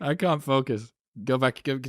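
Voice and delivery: mock whiny student. Host plays the distracted student.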